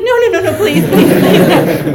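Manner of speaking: loud, high, frantic begging; hammed-up tearful pleading; mock bawling. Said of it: "I'm, like, bawling".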